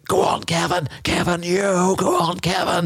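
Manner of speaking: high cheering crowd voice; crowd shouting. Retold or imitated. Runner imitating spectators cheering Kevin, high cheer. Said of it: "people shouting".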